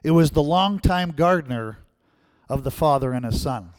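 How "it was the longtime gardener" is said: normal talking voice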